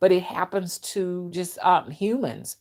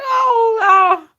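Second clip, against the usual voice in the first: much higher and louder, a laughing mock cry of Oh Lord; mock shocked; 10 dB louder than usual. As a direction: shriek Oh Lord half laughing. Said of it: "I'm like".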